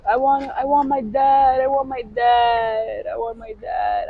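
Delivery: pleading, half-shouted imitation of a crying child; mock crying plea. Imitating her young self begging for her dad, mock crying. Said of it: "I would cry like that".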